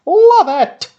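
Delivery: loud, high burst of love for the film; Love said at full volume, high and long; excited shout. Real, not acted. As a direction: belt it out like a fan losing it. Repeats it much louder, gushing.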